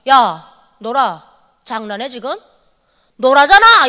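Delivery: slow, loud Pyongan dialect telling-off; angry Pyongan dialect. Retold or imitated. 비싼 마취비에 화가 나 간호사에게 평안도 사투리로 호통. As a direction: snap at the nurse in thick Pyongan dialect. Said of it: "제가 화가 나잖아요".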